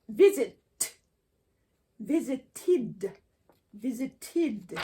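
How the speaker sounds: "visited" ends with a clear extra syllable, id. Shown as a demonstration. Teacher showing the id ending after a T. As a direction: sound out the id at the end of "visited". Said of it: "then it becomes id sound".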